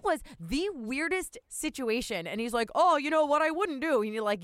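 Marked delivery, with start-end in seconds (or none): 2.7-4.0 high, loud playful quip; hammed-up joking clerk; mock goofy clerk. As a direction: tease like a jokey clerk. Imitating clerk's joke, loud high.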